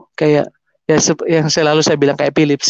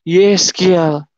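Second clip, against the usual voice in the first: drawn-out call of a name. Retold or imitated. The voice calls his name one last time, drawn-out call. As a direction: stretch the name like a summons. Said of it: "nama yang terakhir itu t-, bilang".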